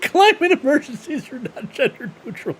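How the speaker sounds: high-pitched, cracked-up echo of the clip; laughing mockery. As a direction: repeat it mockingly through helpless laughter. Repeats clip while cracking up.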